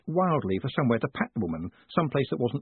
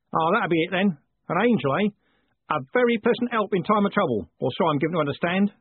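higher-pitched coachman, knowingly playing along; Jim, amused; 7 semitones higher, 4 dB louder than usual. Coachman answering Moist's angel story, higher and knowing. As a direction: play the coachman going along with a tall tale.

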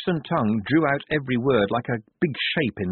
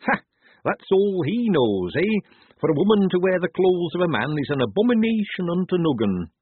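higher, scornful laundry woman laying down the law; scoff, then pronounce it with indignation; scornful laundry mistress; 8 semitones higher, 4 dB louder than usual. A narrator's reading. Missus Enid scoffs at lads in women's clothes, scornful.

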